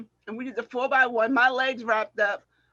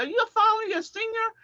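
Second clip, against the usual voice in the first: much higher-pitched imitated question; questioning rival; 9 semitones higher than usual. Rival asks departing senior about her year, high retold question. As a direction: lift the voice high, as a rival asking. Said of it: "the guy said".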